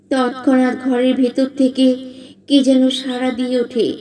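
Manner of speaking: usual speaking voice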